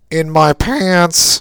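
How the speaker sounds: loud, drawn-out punchline delivery; mock punchline. Podcaster mocking a film's lame punchline, loud and drawn out.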